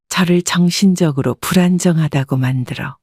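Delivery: plain reading voice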